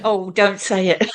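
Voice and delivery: usual speaking voice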